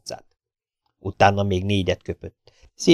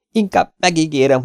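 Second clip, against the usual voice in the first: high, loud panicky promise; frightened pleading; 9 semitones higher, 5 dB louder than usual. A narrator's reading. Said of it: "kiáltott rémülten Ahmed". (rémülten fogadkozva, magas hangon)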